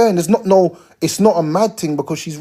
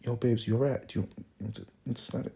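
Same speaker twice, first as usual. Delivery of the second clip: much softer and lower, hushed and tender; soft gentle ask; 7 semitones lower, 14 dB quieter, faster than usual. Retold or imitated. Man gently asking a virgin girl if she's alright, hushed and soft. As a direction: coax her gently, barely above a murmur. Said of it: "how you're dealing with a virgin".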